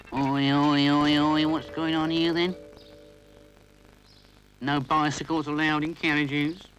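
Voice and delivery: guard breaking up a fuss; stern guard. Acted. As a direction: bark like a railway guard. Guard calling out.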